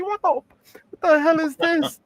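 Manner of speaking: very high, slow startled squeal; mock astonishment. Customer sees the box's contents float, hammed-up high shock.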